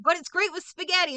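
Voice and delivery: high, bright mock salesman tone; mock cheerful pitch. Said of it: "he's like".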